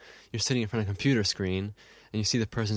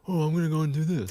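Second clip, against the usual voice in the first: higher-pitched, put-on old-man voice; retrospective old-man voice; 5 semitones higher than usual. Shown as a demonstration. Co-host guessing what Old Man Jake means, old-man voice. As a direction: put on a reminiscing old-timer. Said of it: "my retrospective voice is".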